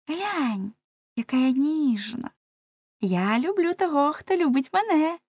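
put-on high, tender-sweet girl's voice; coquettish girl. A narrator's reading. Blonde girl courting Lamme, high coy voice. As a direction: flaunt yourself in a high lilt. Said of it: "сказала вродлива русявочка".

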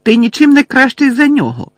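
high, loud accusing shout; Lily yelling at James in anger; furious yell. A narrator's reading. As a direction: hurl it at him.

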